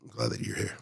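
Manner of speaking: very soft, crying quietly; barely holding it together; tearful. Real, not acted. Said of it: "we're both crying like a bunch".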